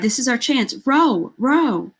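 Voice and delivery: rally them to row hard; woman urging the boat to row; urgent cry. A narrator's reading. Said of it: "Amara cried".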